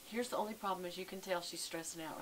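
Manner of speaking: ordinary talk, nothing marked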